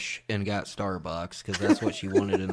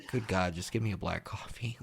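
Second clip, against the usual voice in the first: whispered, fed-up plea; exasperated whisper; 3 semitones lower, 9 dB quieter than usual. Retold or imitated. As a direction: hiss it under your breath, at wits' end. Retelling exasperation in the café.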